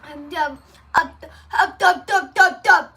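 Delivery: mumbling through a sticky mouthful; tongue stuck, words garbled; mouth full of peanut butter. Real, not acted. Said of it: "I have peanut butter in my mouth".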